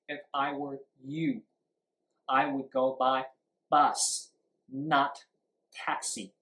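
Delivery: YOU, BUS, TAXI set apart by pauses; sentence stress demo. Shown as a demonstration. English teacher showing the answer, pausing on you, bus, taxi. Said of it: "这是you".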